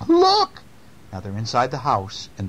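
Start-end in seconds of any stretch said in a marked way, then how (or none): 0.1-0.6 put-on high, loud old-lady cry; high-pitched storybook exclamation; old lady exclaiming. A narrator's reading. Old lady shows the chair.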